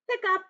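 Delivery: high sharp hiccup sound. Acted. Storyteller voicing elephant's hiccup, high and sudden.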